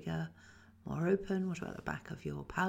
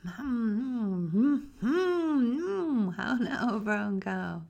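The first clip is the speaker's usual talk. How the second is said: louder and higher with every hum, as if to someone far away; hum, then call the phrase across a distance; calling out farther each time; 5 semitones higher, 8 dB louder, much slower than usual. Shown as a demonstration. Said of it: "speak further and further away".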